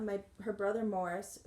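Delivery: ordinary delivery, nothing marked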